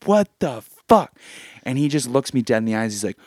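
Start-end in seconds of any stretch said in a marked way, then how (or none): 0.1-1.1 stunned what the fuck. Retold or imitated. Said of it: "I get up, I'm like".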